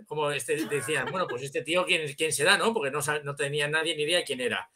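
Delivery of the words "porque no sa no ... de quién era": ordinary delivery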